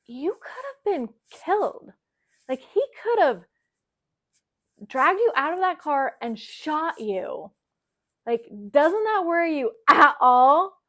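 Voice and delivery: emphatic, pausing reproach, pitched well up; retold incredulous reproach. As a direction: imitate an exasperated partner driving the danger home. Fiance's alarmed reproach, retold.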